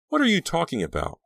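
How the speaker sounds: high, incredulous question; shocked disbelief. A narrator's reading. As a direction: ask it in alarm, pitched high. Girl shocked by the news.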